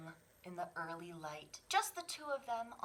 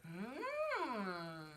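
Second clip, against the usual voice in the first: delighted gasp and hum; 4 semitones higher than usual. Acted. Mother delighted by cakes.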